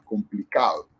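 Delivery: complicado with the weight on "ca"; stress on penultimate. Shown as a demonstration. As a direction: softly show the stressed "ca" in complicado. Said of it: "la fuerza va en esta sílaba".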